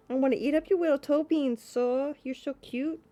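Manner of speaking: high cooing over toe beans. Real, not acted. Streamer cooing at her cat's toe beans, high baby talk.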